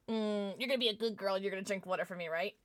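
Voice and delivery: fast, teasing coax; playful coaxing. Acted. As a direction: sweet-talk her into drinking water, fast. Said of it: "I'm gonna test our relationship right now".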